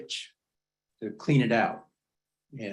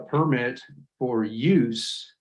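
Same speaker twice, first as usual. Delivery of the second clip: "use" stretched out slowly and pressed hard; slow, pointed reading with "use" stressed; emphasis on use; much slower than usual. Real, not acted. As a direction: lean on the word "use", reading it out deliberately. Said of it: "and I emphasize the word use".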